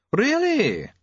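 lightly voiced old doctor; pleased elderly doctor.

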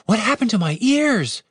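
high-pitched cry of alarm; alarmed shout. A narrator's reading. Elf discovering her pointy ears, shouting in alarm. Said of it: "she shouted".

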